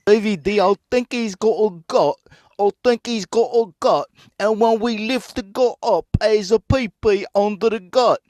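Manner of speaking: high, put-on Aussie voice about the gut; mock Australian accent. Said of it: "Let's do the impression of this Australian guy".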